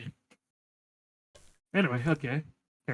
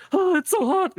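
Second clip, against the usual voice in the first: high, loud mock cry it's so hot; mock overheating; 14 semitones higher, 4 dB louder than usual. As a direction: yelp as if the heat is too much. Mock reaction to getting hot.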